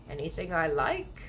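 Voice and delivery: plain reading voice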